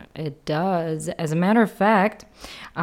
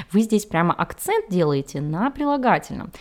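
normal talking voice

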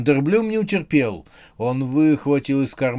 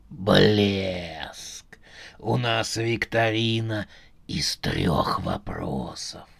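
low, slow robotic voice; slow, low, machine-like quiz host; metallic voice; 5 semitones lower, much slower than usual. Acted. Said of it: "металлический голос".

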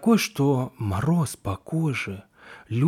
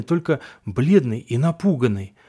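ordinary delivery, nothing marked

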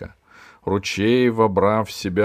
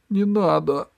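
put-on childlike plea; 10 semitones higher than usual. A narrator's reading. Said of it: "сказал Меркурий".

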